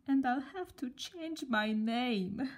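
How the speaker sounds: slow, tearful sob; sobbing. A narrator's reading. Sad girl sobbing about her name. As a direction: cry the words out. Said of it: "she sobbed".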